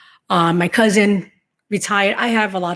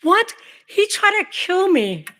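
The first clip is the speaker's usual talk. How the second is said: shocked; 11 semitones higher, slower than usual. Real, not acted. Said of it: "I'm shocked".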